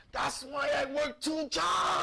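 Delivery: high, loud yell, slow then rushed; drunk father. Retold or imitated. Drunk father complaining to his kids, mock shouted rant. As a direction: imitate a fed-up drunk dad hollering. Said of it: "it's because he was drunk".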